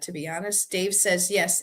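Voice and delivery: ordinary talk, nothing marked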